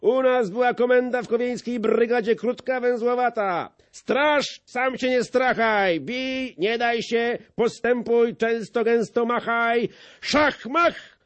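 high, loud barracks talk; soldierly bragging. A narrator's reading. The sprinkler recalls his brigade orders, high and loud. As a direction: rattle off the orders like an old trooper. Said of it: "przerwał chrzciciel".

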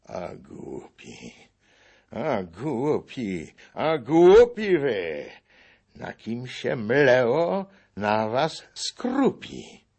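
deliberate, weighty rebuke that grows; slow and emphatic. A narrator's reading. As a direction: drag out each word with pauses, pressing harder. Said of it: "wymawiając zwolna każde słowo z przystankiem i przyciskiem".

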